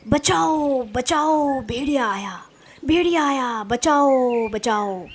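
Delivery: drawn-out mock shouts for help; acted cry for help. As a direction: call out to the village, each cry falling away. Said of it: "जोर जोर से चिल्लाना शुरू कर दिया".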